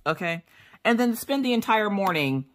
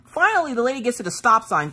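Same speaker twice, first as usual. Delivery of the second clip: usual speaking voice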